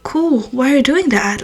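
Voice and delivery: soft curious tone; friendly soft curiosity; interested. Retold or imitated. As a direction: ask gently, no judgement. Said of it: "in a super, like, not in a judging way".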